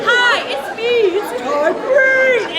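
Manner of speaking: put-on prank-call kid voice. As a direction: do the kid's voice at full tilt, drawling the surname. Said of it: "doing a voice that sounded like a kid".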